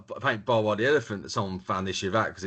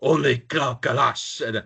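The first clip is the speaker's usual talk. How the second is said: cartoon food vendor; 4 semitones higher, slower than usual. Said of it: "He's like".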